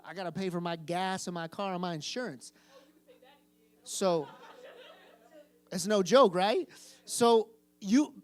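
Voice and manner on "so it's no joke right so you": much louder, hammering the point; loud preacher's emphasis; emphatic shout. Real, not acted. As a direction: belt it out to the room. Preacher driving point home.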